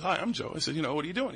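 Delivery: ordinary talk, nothing marked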